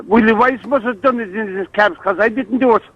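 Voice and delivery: ordinary talk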